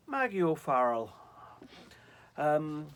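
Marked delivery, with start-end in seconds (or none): 0.1-1.1 put-on loud, high-starting go at an Irish accent; attempted Irish accent. Reader tries the author's very Irish name, attempted Irish accent. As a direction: try an Irish lilt on the name, not very well. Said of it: "I can't do an Irish accent".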